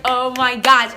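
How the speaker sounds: loud retold outburst at herself; exasperated dismay. Telling friends about her crush blunder, exasperated outburst. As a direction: burst out in mock despair.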